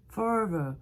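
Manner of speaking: "Forever" ending in a vowel, no R; R replaced by E. Shown as a demonstration. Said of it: "Remplacez le R par un E".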